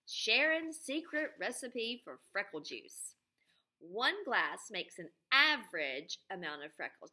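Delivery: teacher reading a recipe list to her class; reading to children. Acted. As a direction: announce each ingredient to the kids. Reads the recipe aloud to class.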